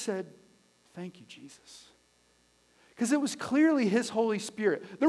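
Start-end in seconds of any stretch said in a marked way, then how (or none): 0.9-1.9 low, hushed murmur; retold whisper. Husband praying beside his sleeping wife, hushed. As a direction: softly imitate a whispered thanks. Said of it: "and I just whispered".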